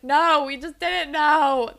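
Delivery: slow, drawn out, lightly put-on voice; a hint of awkward denial; lightly voiced slow excuse; mock embarrassed reply. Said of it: "And it's like".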